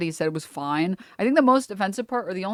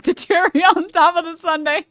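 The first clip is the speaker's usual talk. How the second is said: laughing; 8 semitones higher, 6 dB louder than usual. Real, not acted. Laughing at sponsor joke.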